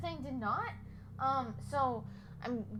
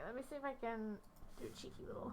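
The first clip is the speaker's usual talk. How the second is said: whispering; 13 dB quieter, faster than usual. Real, not acted. Whispering aside.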